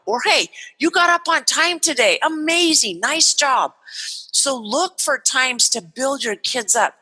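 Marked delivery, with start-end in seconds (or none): 0.2-3.8 bright praise. Shown as a demonstration. Acting praise to a child.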